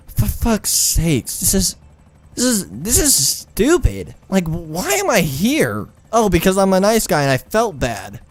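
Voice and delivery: high, drawn-out exasperated complaint. Retold or imitated. Acting out exasperated inner complaint.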